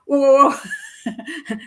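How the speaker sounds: hammed-up shocked protest; retold alarmed whoa. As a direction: stretch each whoa in mock alarm, half laughing. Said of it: "And I'm like".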